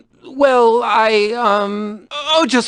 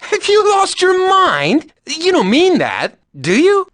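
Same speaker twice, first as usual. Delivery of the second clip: high, slow, disbelieving protest; can't believe what she just heard; incredulous outburst; 8 semitones higher than usual. Acted. Outraged at refusing her company.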